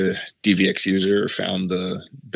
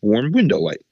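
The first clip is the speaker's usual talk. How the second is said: louder, pleased confirmation; decisive; 5 semitones higher, 6 dB louder than usual. Retold or imitated. Gaffer names the look for the DP, loud and pleased. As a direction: announce it like a solved puzzle. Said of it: "he goes".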